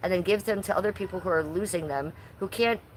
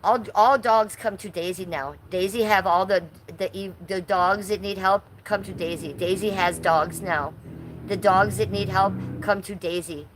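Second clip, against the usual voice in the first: ordinary talk, nothing marked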